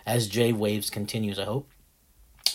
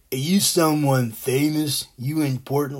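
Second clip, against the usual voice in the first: clueless fan; 4 semitones higher, 6 dB louder than usual. Retold or imitated. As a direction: play the nosy giant asking with a dumb grin. Tall guy nosily asking Landis.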